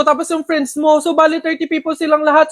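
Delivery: ordinary talk, nothing marked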